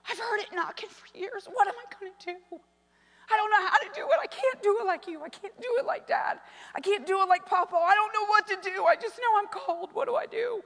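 very high, overwrought pleading; imitating a kid's desperate high-pitched outpouring; imitated tearful son. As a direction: act out a distraught boy wailing about his calling. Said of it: "of course he began to cry".

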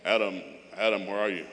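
low, loud voice calling out; deep, searching call; God calling for Adam. Retold or imitated. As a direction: play God calling out through the garden. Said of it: "God comes walking through the garden".

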